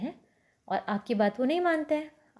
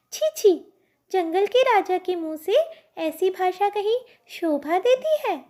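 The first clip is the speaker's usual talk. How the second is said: much higher, scolding little fly; tut-tut like a prim little fly scolding the king; tiny fly voice; 8 semitones higher than usual. Acted. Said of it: "अब मक्खी क्या बोली फिर?".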